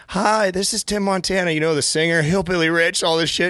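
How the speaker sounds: high, eager self-promoting pitch; name-dropping. Retold or imitated. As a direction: pitch yourself like a star. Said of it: "And I was like, again".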